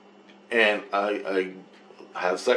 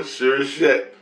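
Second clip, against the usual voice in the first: ordinary talk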